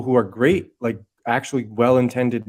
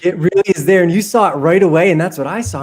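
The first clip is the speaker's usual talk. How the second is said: excited; 6 semitones higher, 7 dB louder than usual. Real, not acted. Excited agreement with host.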